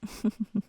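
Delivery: short breathy giggle; a pleased little laugh; light laugh. Real, not acted. After the kiss.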